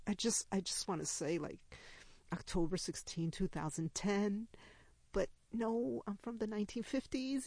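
usual speaking voice

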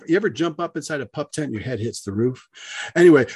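ordinary delivery, nothing marked

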